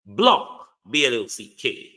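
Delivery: block, letter by letter: B L O C K; spelled out. Shown as a demonstration.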